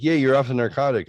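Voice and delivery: lightly imitated upbeat wife; mock-cheerful wife. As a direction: retell an upbeat congratulation, quick and high. Retold wife cheering at mall.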